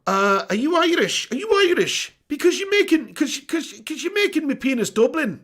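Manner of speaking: high, put-on Irish accent; Dublin accent. Streamer fumbling an Irish chat-up line, high Dublin accent. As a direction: try a pick-up line in high-pitched Dublin. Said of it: "Dublin accent".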